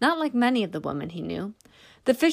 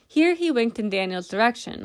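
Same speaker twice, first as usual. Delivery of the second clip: usual speaking voice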